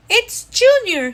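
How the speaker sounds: high, loud proud cry; excited shout. Acted. Father bird greeting the newly hatched baby with a joyful shout. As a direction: shout the name with joy. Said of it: "he shouted".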